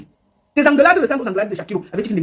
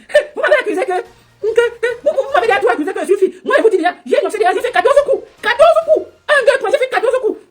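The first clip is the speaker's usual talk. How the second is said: very high-pitched mock of the rival; imitating someone's high, thin voice; 11 semitones higher than usual. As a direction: squeak out the boast in a mocking, too-thin voice. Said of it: "La voix est trop fine".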